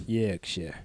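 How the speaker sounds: put-on Yorkshire accent, drawn out slowly; hammed-up slow Yorkshire; bad Yorkshire accent. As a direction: stretch it into a shocking Yorkshire. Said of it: "Shocking Yorkshire accent".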